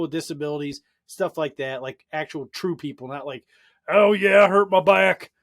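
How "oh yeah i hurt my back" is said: exaggerated old codger griping about his back. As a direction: ham up a lazy guy's excuse.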